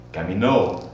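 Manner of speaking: Caminó with the stress on the final ó; model for repeating. Shown as a demonstration. Teacher models caminar conjugation for class, stressing the final ó. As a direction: say Caminó clearly, leaning on the last ó. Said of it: "Repeat after me".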